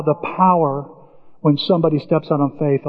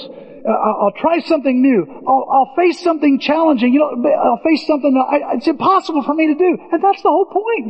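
high, whiny believer voice; high plaintive imitation of a believer; whiny voiced believer; 8 semitones higher than usual. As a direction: mimic a doubting believer, pitched up high. Preacher voicing a believer's doubts.

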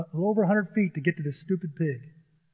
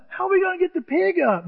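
high-pitched anxious query; mock alarm, voice pitched well up; worried questioning; 11 semitones higher than usual. Player on rope asks guide for help, worried.

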